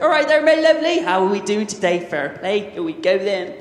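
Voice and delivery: big, loud Bristol greeting, "my lovely" thrown up high; full West Country charm, cheerful and over the top; hammed-up Bristol accent. Proud new Bristolian hamming up the local accent for the audience. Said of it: "I'm a proper Bristolian".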